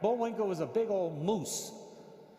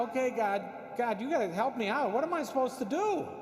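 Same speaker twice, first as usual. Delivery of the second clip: pleading at a higher pitch; mock desperate plea; 7 semitones higher, 4 dB louder than usual. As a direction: beg God for help, playing up the helplessness. Retold desperate plea to God.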